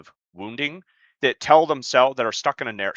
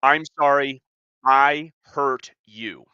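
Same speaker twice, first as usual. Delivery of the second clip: sincere and louder, "I hurt you" drawn out slowly; real apology; 3 semitones higher, 6 dB louder, much slower than usual. Shown as a demonstration. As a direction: own it, earnest and slow on the last words. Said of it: "That's a real apology".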